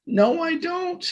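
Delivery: high, slow frightened squeak; squeaky voice. A narrator's reading. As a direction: squeak it out in fear. Scared Wilbur denies.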